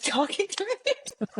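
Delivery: high, laughing through the words. Real, not acted. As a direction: giggle it out, slowly. Laughing at the memory.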